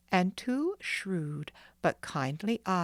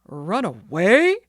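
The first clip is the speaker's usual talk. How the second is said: a much louder, slow, thundering roar; roaring outrage; 12 dB louder, slower than usual. Acted. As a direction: roar it out in disbelief. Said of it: "roared the doctor in a terrible voice".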